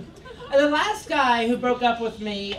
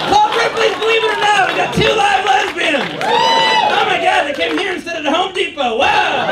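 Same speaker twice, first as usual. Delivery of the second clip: much higher, louder barker shout; carnival barker; 7 semitones higher, 8 dB louder than usual. Acted. Spotting two lesbians in the crowd, a loud carnival barker shout. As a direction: hype them like a sideshow attraction. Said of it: "announcing lesbians in crowd".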